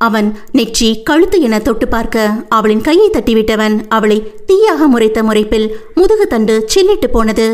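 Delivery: ordinary delivery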